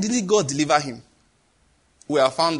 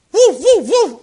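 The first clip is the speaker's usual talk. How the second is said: animal howl; 11 semitones higher, 7 dB louder, slower than usual. Acted. Imitating Nebuchadnezzar as animal.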